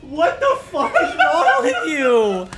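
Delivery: high, loud shocked shriek through laughter; shocked screaming. Real, not acted. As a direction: scream it, heart pounding from a fright. Jump-scared by friend in doorway.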